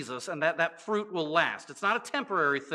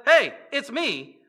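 higher, louder, laid-back Jesus voice; a breezy Jesus answering his friend; casual Jesus; 5 semitones higher, 6 dB louder than usual. Retold or imitated. Jesus answering Peter from the water, breezy.

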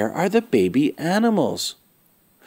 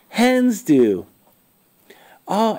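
plain reading voice

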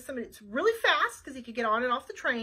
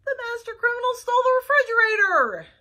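louder, higher, despairing; exasperated chef; 10 semitones higher, 9 dB louder than usual. Acted. Chef crying out to Flytrap in despair, loud. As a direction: throw up your hands.

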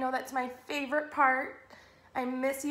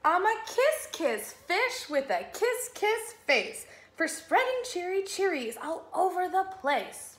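cheery kiss fish; 6 semitones higher than usual. Acted. Transformed fish spreading cheery cheeries, exuberant. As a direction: belt out the happy refrain. Fish's cheerful new refrain.

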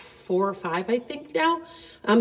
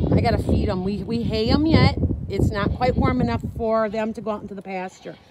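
ordinary delivery

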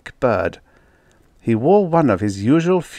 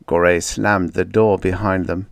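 usual speaking voice

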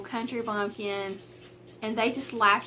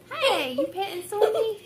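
playful mom voice; 9 semitones higher than usual. Real, not acted. Mom turns to her child.

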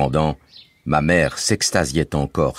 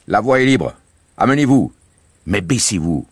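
put-on higher, lively voice for the boy Bouzig; Bouzig's voice; 6 semitones higher, 4 dB louder than usual. A narrator's reading. Bouzig reassuring the family, bright boyish voice. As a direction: play the cheerful young guide Bouzig.

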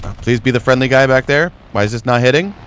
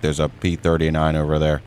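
ordinary delivery

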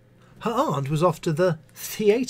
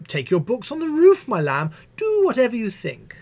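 higher, exasperated teacher voice; weary teacher; 8 semitones higher, 4 dB louder than usual. Acted. Missus Butler fobbing off the pupil, weary sweetness. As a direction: brush the child off sweetly.

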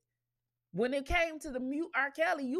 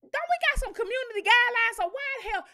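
indignant; 10 semitones higher, 4 dB louder, faster than usual. Real, not acted. Indignant rant at Paramount.

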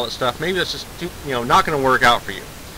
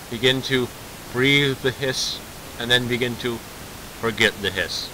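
mock meditation; 5 dB quieter than usual. Mock guided meditation about hiss.

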